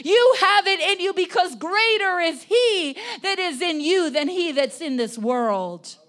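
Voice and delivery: loud, high preacher's declaration; impassioned preaching. Real, not acted. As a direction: preach it out with full force. Preaches at full force.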